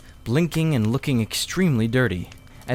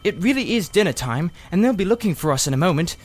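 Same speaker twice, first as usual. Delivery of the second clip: put-on boy's voice, higher and hurried; a boy insisting they must go; Diggory, urgent; 8 semitones higher than usual. A narrator's reading. Diggory urging his uncle to let them out, higher and hurried.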